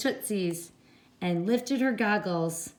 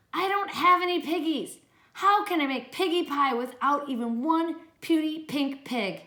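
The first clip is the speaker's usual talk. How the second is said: high fretful witch complaint; 6 semitones higher than usual. Acted. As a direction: whine like a hungry witch. Witch Gritch complains in story.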